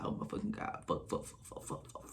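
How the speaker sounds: whispered string of curses; panicked whisper. Retold or imitated. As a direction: curse under your breath in panic. Panicking as neighbour stares.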